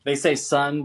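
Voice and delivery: normal talking voice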